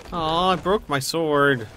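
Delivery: ordinary talk, nothing marked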